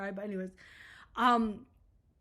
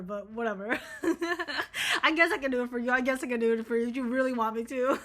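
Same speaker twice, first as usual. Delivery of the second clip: giggly, nervous mock modesty; flustered laughing. Picturing a client using her design, laughing and flustered. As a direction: laugh through it, pretending to be overwhelmed.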